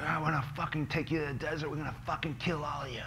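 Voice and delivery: a cop sneering at prisoners he'll kill them; mock police threat. To the prisoners in the truck, a cop's fast threat. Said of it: "they're like".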